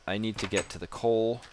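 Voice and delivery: ordinary talk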